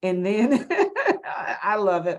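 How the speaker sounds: loud delighted laugh breaking into the words. Real, not acted. Host delighted by guest's accent, laughing loudly.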